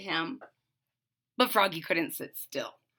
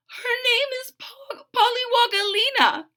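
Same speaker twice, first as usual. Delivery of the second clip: mother's voice; 4 dB louder than usual. A narrator's reading. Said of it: "said Mom".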